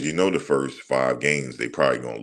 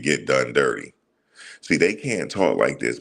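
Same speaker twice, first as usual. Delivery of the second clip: ordinary delivery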